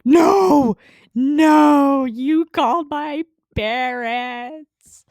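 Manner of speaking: shouted, high and loud, then slow; distraught retold scream; panicked shout. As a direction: yell it in betrayed panic. Sees dad's feet, panics.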